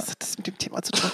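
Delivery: whispered, voiceless aside. Real, not acted. Cohost whispering an aside about the topic. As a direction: slip the question in a whisper.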